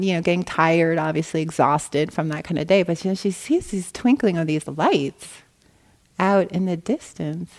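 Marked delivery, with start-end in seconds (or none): none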